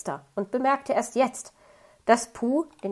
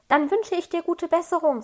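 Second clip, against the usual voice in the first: put-on young fox cub, earnest and worried; a hint of a worried little fox; 7 semitones higher, 3 dB louder than usual. A narrator's reading. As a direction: pitch it up a little like a small child pleading. Little fox says goodbye.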